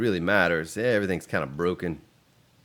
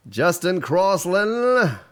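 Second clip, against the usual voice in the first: loud, high, drawn-out name call; called-out greeting; 10 semitones higher, 7 dB louder, much slower than usual. Real, not acted. Streamer greeting a viewer by name, high drawn-out call. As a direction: sing out the name, stretched long.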